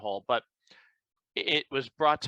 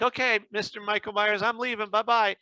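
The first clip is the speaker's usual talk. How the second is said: put-on higher, chirpy child's goodbye; lightly voiced cheerful kid; dad imitating his daughter's goodbye, lightly voiced kid; little girl's voice; 7 semitones higher, 3 dB louder than usual. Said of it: "And she's like".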